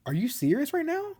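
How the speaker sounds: high, baffled retold question; confused disbelief. He questions Shane's sudden retreat, confused and shocked. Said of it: "He's confused".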